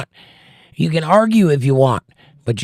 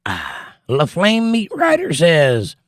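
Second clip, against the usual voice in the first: ordinary talk, nothing marked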